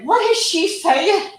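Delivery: high, slow puzzled voice; a light mock of a baffled listener; imitating Crystal. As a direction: mimic a confused friend. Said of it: "But she would say".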